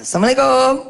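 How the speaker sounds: put-on high, loud child's greeting at the door; calling out greeting. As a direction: call out arriving home. Boy calling home. (salam anak yang lantang)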